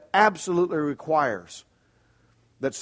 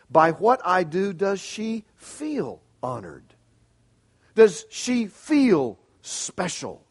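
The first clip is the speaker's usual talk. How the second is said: probing questions; 4 semitones higher, 6 dB louder than usual. Acted. Preacher poses searching questions.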